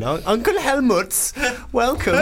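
slow, high put-on German voice; mock German accent. Man welcoming an imaginary German uncle, mock German accent. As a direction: play a silly German greeter, high and slow. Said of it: "He's German".

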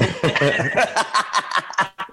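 high giggling laugh. Real, not acted. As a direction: crack up laughing. Laughing at his own joke.

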